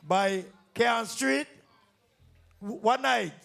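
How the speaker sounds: normal talking voice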